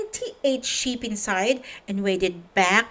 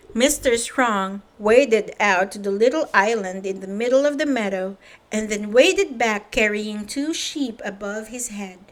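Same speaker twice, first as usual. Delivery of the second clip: ordinary delivery, nothing marked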